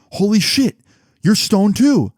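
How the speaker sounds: high exclamation of disbelief; acted shocked dad. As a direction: mimic a dad catching another culprit. Acted dad catching second boy.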